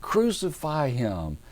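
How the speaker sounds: high, drawn-out demand; mock angry crowd. Preacher imitating the angry crowd, high slow mock demand. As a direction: chant it slowly like a worked-up mob, not shouting. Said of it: "They're all hot".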